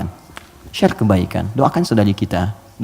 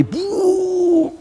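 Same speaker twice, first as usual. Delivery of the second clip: imitated burst noise. Sound of world collapsing.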